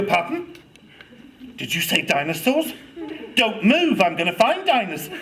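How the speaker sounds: loud, high burst of eagerness; keen, hammed-up urgency; urgent excitement. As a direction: act thrilled, as if dropping everything at once. Acted teacher eagerly seizing child's interest.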